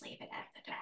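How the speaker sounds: whispered aside. Real, not acted. Aside about the delivery guy.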